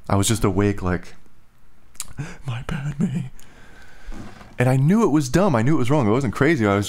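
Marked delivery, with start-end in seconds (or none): 2.4-3.3 soft, breathy aside; whispered apology. Real, not acted. Whispering sorry to May mid-story. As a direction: murmur an apology under the breath.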